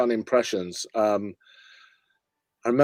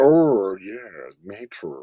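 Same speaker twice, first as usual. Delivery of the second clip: camp comic voice; Kenneth Williams; 4 semitones lower, much slower than usual. Acted. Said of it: "someone like Kenneth Williams".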